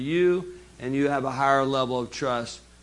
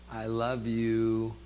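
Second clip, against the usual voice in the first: slow, softer tender I love you; 4 semitones lower, 5 dB quieter, slower than usual. Shown as a demonstration. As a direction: say it slowly and softly, like you mean it. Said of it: "See the difference in the tone of voice".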